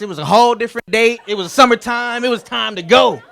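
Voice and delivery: loud, high, rising comic insistence; emphatic protest. Real, not acted. Best man defending Miami choice, loud mock outrage. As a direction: build it up like a rallying cry.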